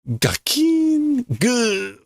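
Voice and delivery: high-pitched clang and groan effect; imitated clonk. Reader voicing a man clonked in the head, as mock impact noises. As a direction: voice a high, ringing knock on the head. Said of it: "gets clonked in the head".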